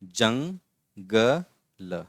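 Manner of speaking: "Jungle" drawn out very slowly in separate syllables. Shown as a demonstration. Teacher sounding out "jungle" for pupils, syllable by syllable. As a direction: break "jungle" into slow pieces with pauses.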